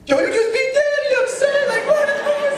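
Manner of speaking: high, loud sing-song disbelief; amazed sing-song. Retold or imitated. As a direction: sing it out in shock. Brother amazed, shouting sing-song.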